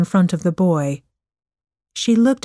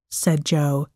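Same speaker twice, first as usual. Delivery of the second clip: usual speaking voice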